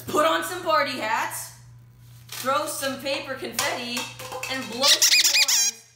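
excited. Acted. Party announcement.

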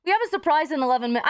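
usual speaking voice

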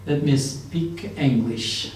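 each word drawn out slowly; slow teacher's model sentence; very slow drill. Shown as a demonstration. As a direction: spell it out word by word for the class. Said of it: "دقت بفرمایید".

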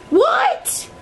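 very high squeaky what; high astonished yelp; shocked what. Real, not acted. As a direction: squeak in disbelief. Escalating confusion.

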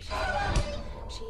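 high, loud scared squeal; panicked shriek. Real, not acted. Granny coming upstairs.

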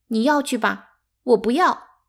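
high, loud hurt refusal; upset child's loud protest; tearful. A narrator's reading. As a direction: snap back through tears. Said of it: "八姐擦着眼泪说".

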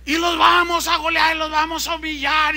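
high, loud rally cry; boastful taunting shout. Retold or imitated. Organizer promising to humiliate the other team, taunting shout. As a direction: crow over the rivals to the stands.

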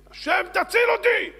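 high, loud desperate plea; full-voiced pleading yell; shouted cry to God. A narrator's reading. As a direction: scream for rescue from above. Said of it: "וצועק".

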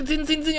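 bell sound imitation.

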